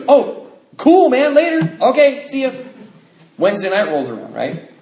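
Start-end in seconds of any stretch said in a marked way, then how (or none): 0.1-2.5 higher, louder breezy buddy voice; cheery buddy. Retold or imitated. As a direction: toss off a happy goodbye like a buddy. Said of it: "He goes".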